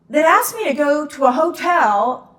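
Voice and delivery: high, loud, laughing as it dawns; giddy laughing disbelief. Real, not acted. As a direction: laugh through the reveal. Realising who he was.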